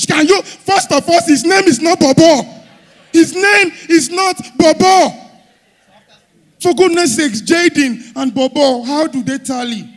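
high, loud indignant rant; comic outrage. Acted. Comedian ranting that the baby's name isn't Bobo, high and loud. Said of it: "comedian ranting at woman".

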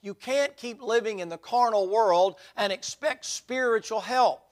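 preached loud and high, leaning on each phrase; fervent preaching. Acted. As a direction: drive the point home from the pulpit. Preaching opening of sermon.

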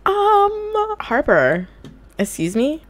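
mock outrage at her sim; indignant surprise. Real, not acted. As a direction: call her out, scandalised. Scolding the sim.

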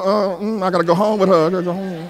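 mumbled aside from the pews, mock reluctance; imitated reluctant brother. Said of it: "That brother back there talking about".

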